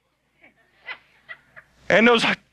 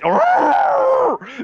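mock cow wail; 13 semitones higher than usual. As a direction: bellow it out like a hit cow. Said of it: "make a wailing noise".

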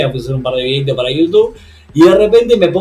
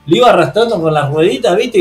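ordinary delivery

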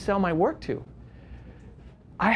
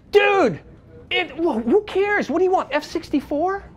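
exasperated protest; 10 semitones higher than usual. Retold or imitated. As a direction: throw your hands up and argue it. Arguing with the judges.

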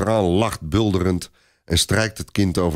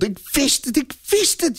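very high, jubilant squeal; overjoyed high-pitched repetition; joyful cheering. A narrator's reading. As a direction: cheer it happily, voice soaring. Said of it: "juicht ze blij".

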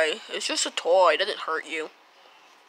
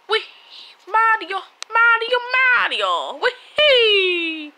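high squeaky Mario cry; hammed-up Mario yelling his name; Mario impression; 11 semitones higher, 8 dB louder, much slower than usual. Playful Mario voice, much higher.

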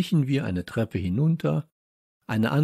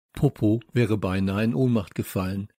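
usual speaking voice

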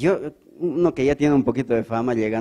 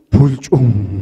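louder splash word, paceño style; paceño splash sound; 9 dB louder than usual. Acted. Splash of the angel diving, said paceño style. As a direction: say the dive the La Paz way. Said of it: "A lo paseño".

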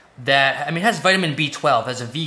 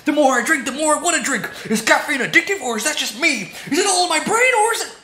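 wired and giddy; 9 semitones higher, faster than usual. Pretend. Giddy caffeine rambling.

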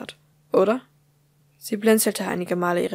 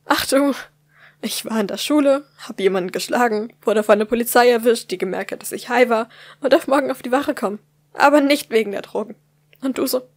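higher, louder desperate mock cheer; bitter sarcasm; 3 semitones higher, 7 dB louder than usual. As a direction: list disasters with bitter irony. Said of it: "Sie lachte verzweifelt auf".

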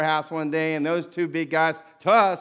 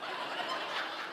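breathless laugh; 16 dB quieter than usual. Real, not acted. Laughs at the memory.

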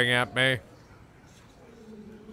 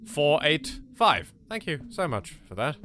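normal talking voice

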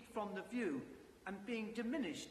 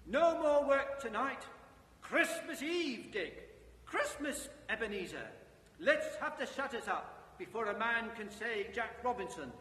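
loud, higher jolly boss voice; rally the lads cheerfully; jovial voice; 4 semitones higher, 6 dB louder than usual. Acted. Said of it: "comfortable, oily, rich, fat, jovial voice".

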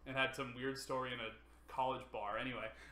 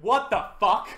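imitating a shocked yell; 7 semitones higher, 13 dB louder than usual. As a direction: retell the opponent's stunned shout, louder and higher. Opponent reacts to the Samus reveal.

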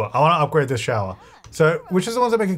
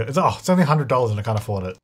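normal talking voice

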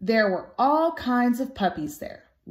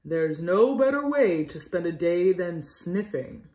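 lightly voiced dog character, lower than the narration; a hint of a deeper character voice; Axel; 4 semitones lower than usual. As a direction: read it as the dog's own line, pitched down. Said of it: "His name was Axel".

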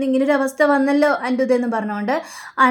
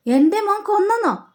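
എൻ്റെ മോൻ കൊന്നെന്നോ with കൊന്നെന്നോ pitched much higher in shock; shocked disbelief; 7 semitones higher than usual. Retold or imitated. Mother stunned by news of her son, high shocked disbelief.

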